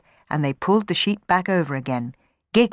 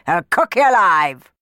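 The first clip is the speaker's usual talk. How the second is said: loud threat; 2 semitones higher, 7 dB louder than usual. Acted. Bob threatens Sammy.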